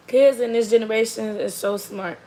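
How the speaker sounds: ordinary talk, nothing marked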